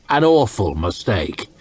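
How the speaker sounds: usual speaking voice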